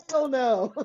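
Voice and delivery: high, drawn-out mock refusal; laughing-edged, high-pitched no way; emphatic refusal. Comic refusal to adult children.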